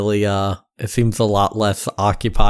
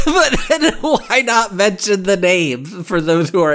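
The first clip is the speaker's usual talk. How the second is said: laughing; 9 semitones higher, slower than usual. Real, not acted. Laughing while arguing.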